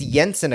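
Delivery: "Jensen" said YEN-sen; "Jensen" starting like yes, as the club says it; club's pronunciation. Shown as a demonstration. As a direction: say "Jensen" with a Y sound for the J. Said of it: "according to the club".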